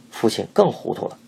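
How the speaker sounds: plain reading voice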